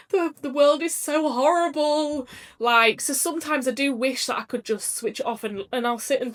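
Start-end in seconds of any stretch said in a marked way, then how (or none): 0.1-2.3 slow, higher, tearful mock lament; imitated crying. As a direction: replay her own sobbing despair, slowed down. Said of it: "I'd just sit there and cry".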